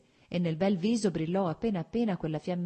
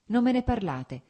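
quickly and higher, cutting herself off; hurried; 7 semitones higher, 6 dB louder than usual. A narrator's reading. Kitty hastily adds to Varinka, hurried. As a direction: hasten to add it, a bit louder. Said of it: "aggiunse in fretta".

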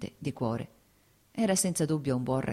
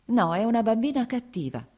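higher and louder, fed-up complaint; exasperated mother; 8 semitones higher, 5 dB louder than usual. Acted. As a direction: vent your anger about the child. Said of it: "disse rivolta a Lievin".

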